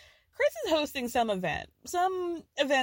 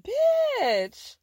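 excited exclaim; 6 dB louder than usual. Real, not acted. Gushing over a caviar-tin ring.

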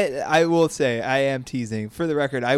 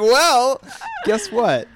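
laughing through the words, higher and louder; 11 semitones higher, 5 dB louder, much slower than usual. Retold or imitated. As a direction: laugh it out, teasing. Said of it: "I was like".

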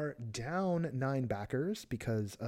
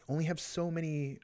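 usual speaking voice

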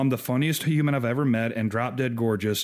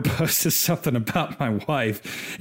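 normal talking voice

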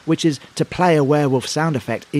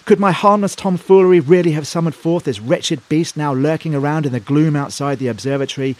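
normal talking voice

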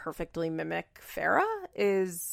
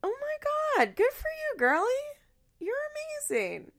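put-on high gushing girly voice, pitched way up; gushing fan voice. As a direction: coo it like an adoring superfan. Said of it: "I too would be like".

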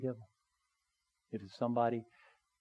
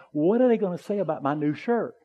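higher and louder, mock-anxious self-talk; mock fretting; 7 semitones higher, 8 dB louder than usual. Preacher joking to the congregation about his shirt, mock-anxious. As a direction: ham up a silly worry. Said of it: "I'm going".

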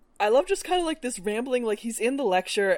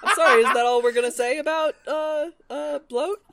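louder, laughing, mock indignant; laughing tease; 7 semitones higher, 7 dB louder than usual. Real, not acted. Teasing repeat, laughing.

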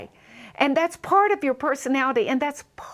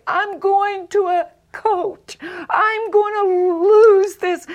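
raised, higher, half-crying cry of frustration; exasperated vow; 7 semitones higher, 6 dB louder, slower than usual. Retold or imitated. Speaker retells despairing about her accent, mock anguished cry. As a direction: wail it like you can't stand your own voice. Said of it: "I would hear myself on radio or television and say".

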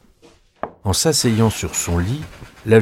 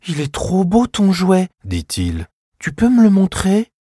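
put-on much higher small boy pleading; little boy voice; 10 semitones higher than usual. Fritz begs his sister to see the toy, high. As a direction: play a young boy asking.

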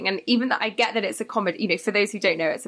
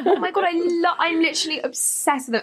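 laughing gush; 11 semitones higher than usual. Real, not acted. Gushing about loving the characters.

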